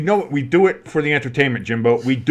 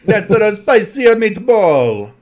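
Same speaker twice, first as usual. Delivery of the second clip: high, slow, laughing bad Italian accent; 8 semitones higher, 6 dB louder, much slower than usual. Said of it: "you're doing bad Italian accents".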